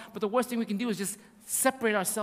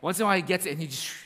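ordinary talk, nothing marked

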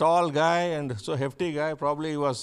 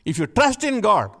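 high, loud, building sermon cry; fiery preaching; 8 semitones higher, 6 dB louder than usual. Acted. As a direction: preach it out to the whole church at full force. Preaching with rising force.

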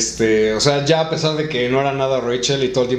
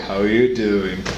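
a light, quick take on Joey's "How you doing?"; a hint of Joey greeting a woman; Joey impression; 4 semitones lower, much slower than usual. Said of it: "Joey era el típico saludo de".

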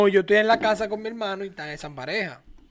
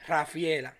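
low, soft deadpan utterance; hushed, low retold provocation; quiet name drop; 7 semitones lower, 11 dB quieter, slower than usual. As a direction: drop the name quietly after a suspenseful pause. Fateful name retold after suspense.

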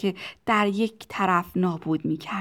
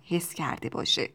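ordinary delivery, nothing marked

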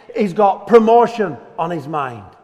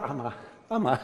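ordinary talk, nothing marked

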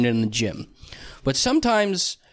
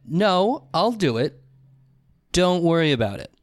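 separate, weighted phrases with pauses; imitated curt refusal. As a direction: act out a firm, dismissive brush-off. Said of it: "But it was a".

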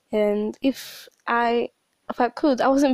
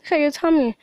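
a hint of a stern mom, raised; imitating mom; 6 semitones higher, 4 dB louder, faster than usual. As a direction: point it out like a scolding mother. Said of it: "She's like".

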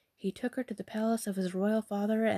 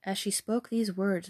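usual speaking voice

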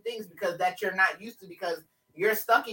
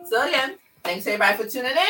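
usual speaking voice

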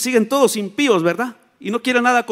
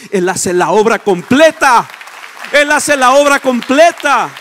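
loud, drawn-out preaching cry; emphatic proclamation; 5 semitones higher, 12 dB louder, slower than usual. Real, not acted. Preacher declaring God finishes the work, loud proclamation. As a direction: proclaim it slowly at full volume.

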